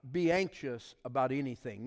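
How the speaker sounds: preacher booming the verse; emphatic scripture reading. Acted. Pastor reading the verse to the congregation, emphatic. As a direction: read it out with force.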